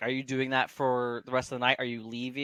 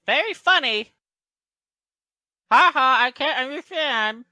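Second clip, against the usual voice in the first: high, loud mock amusement; sarcastic retort; 13 semitones higher, 10 dB louder than usual. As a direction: fake the laugh in a piping halfling voice. Halfling retorts to untranslated jibe.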